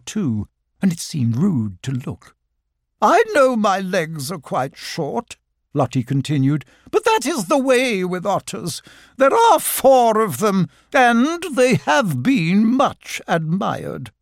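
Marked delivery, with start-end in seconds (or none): 3.0-5.4 slow, higher, prim lady otter; a genteel otter, slow and proud; Lottie the otter. Acted. Lottie defending her legs to Christopher Robin, slow and prim. 6.9-14.1 slow, higher, prim lady otter; a genteel otter, slow and proud; Lottie the otter. Acted. Lottie defending her legs to Christopher Robin, slow and prim.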